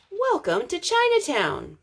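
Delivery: usual speaking voice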